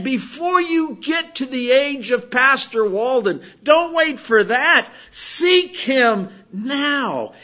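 loud, rousing preacher's exhortation; preaching at full force. Acted. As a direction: preach it out to the whole church. Preacher exhorts the congregation loudly.

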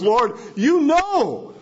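"know" high, long and falling; emphatic appeal. Retold or imitated. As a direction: stretch "know" right out in earnest appeal. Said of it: "even more emphatic".

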